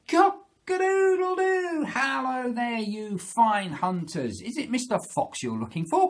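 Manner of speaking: high, loud crowing call; Mister Cockerel. Acted. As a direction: crow out to distant hunters. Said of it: "threw back his head and he called out".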